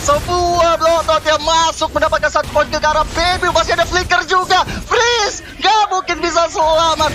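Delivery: rising hype, pitch shooting up; fighting game announcer. Real, not acted. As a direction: peak play-by-play yell on the kill. Climactic team fight call. (komentator heboh berteriak)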